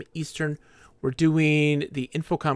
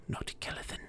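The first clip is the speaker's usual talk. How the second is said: whispered scolding; 15 dB quieter, faster than usual. Acted.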